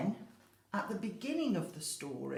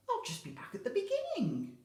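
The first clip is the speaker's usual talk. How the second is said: put-on puzzled old lady; 13 semitones higher, faster than usual.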